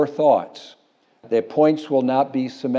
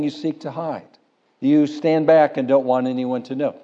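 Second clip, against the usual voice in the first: ordinary talk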